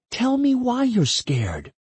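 high, slow, drawn-out plea; coaxing woman. Acted. Woman coaxes scared partner to explain, pleading. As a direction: coax it out slowly, voice held high.

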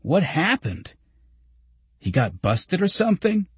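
high, puzzled questioning; astonished. A narrator's reading. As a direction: ask in baffled surprise, pitch climbing. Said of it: "said Greg in astonishment".

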